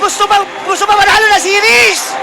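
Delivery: high, hurried celebratory yell; excited shout. Real, not acted. Co-caster yells after the series win, excited shout. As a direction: shout it at the top of your range.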